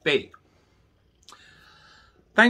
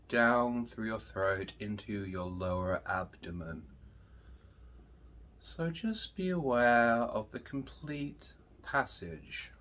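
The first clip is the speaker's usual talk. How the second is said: guided meditation; 10 dB quieter, slower than usual. Acted. Teacher leading students through breathing, slow and soft. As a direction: guide them into calm, slowly.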